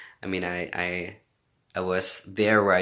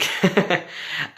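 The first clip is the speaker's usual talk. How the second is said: embarrassed laugh; 10 semitones higher, 8 dB louder than usual. Real, not acted. Laughs at flirting question.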